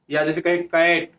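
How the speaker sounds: ordinary talk, nothing marked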